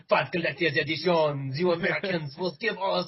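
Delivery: hammed-up French accent taunting Americans; lightly put-on French accent. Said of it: "I'll start doing the French accent".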